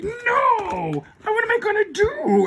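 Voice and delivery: very high villainess wail; hammed-up squealing panic; Rita. Villain facing the united team, high mock wail.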